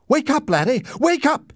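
high, loud wake-up cry; mother waking her son in a burning room; urgent shout. A narrator's reading. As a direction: shake him awake with your voice. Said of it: "she shouted".

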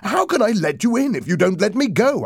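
desperate, high, loud shouting. A narrator's reading. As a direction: shout it urgently, pitched well up. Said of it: "I shouted".

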